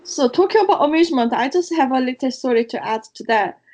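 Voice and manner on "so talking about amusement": normal talking voice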